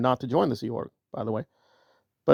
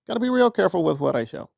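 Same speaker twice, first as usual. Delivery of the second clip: ordinary delivery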